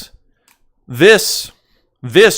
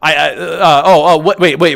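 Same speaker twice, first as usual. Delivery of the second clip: normal talking voice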